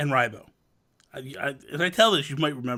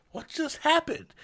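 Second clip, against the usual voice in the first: a high, softer puzzled quote; mock bewilderment; 10 semitones higher, 5 dB quieter than usual. Teammates stunned when Nasty logs off, mock bewilderment. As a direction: lightly play the confused teammates. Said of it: "we're like".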